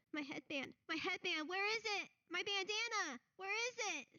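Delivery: rising high, frantic questions; panicked child. Acted. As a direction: build to panic. NPC panics about headband.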